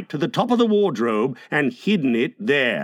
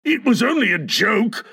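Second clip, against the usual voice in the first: mild roar with raised pitch; 6 semitones higher than usual. A narrator's reading. Husband defending his joke, restrained roar. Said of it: "he roared".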